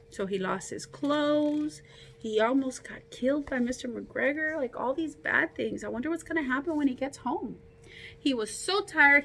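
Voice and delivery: ordinary delivery, nothing marked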